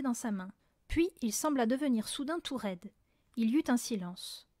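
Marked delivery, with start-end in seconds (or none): none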